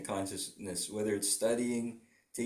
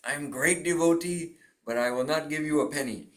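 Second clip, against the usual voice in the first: put-on Indian accent; 5 semitones higher, 6 dB louder than usual. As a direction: play a stingy devotee with an Indian accent. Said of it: "I'm doing an Indian accent".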